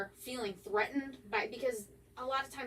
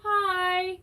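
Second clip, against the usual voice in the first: put-on little girl; 8 semitones higher, 8 dB louder than usual. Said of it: "I heard a little girl go".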